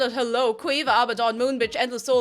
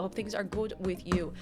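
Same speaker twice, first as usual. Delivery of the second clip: usual speaking voice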